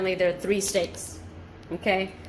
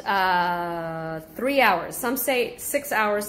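ordinary delivery

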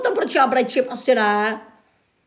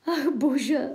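normal talking voice

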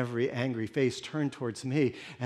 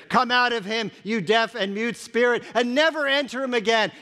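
commanding; 8 semitones higher, 4 dB louder than usual. Acted. Jesus commands the spirit out.

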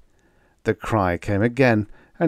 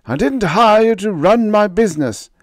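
loud, much higher angry retort; snapped; 12 semitones higher, 8 dB louder than usual. A narrator's reading. Scientist telling the captain to back off, angry loud snap. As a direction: cut him off coldly. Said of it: "Renzo snapped".

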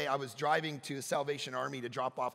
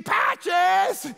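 high, loud yell for a lost dog; frantic call for Patches; 13 semitones higher, 11 dB louder than usual. Retold or imitated. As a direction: shout the dog's name out the car window.

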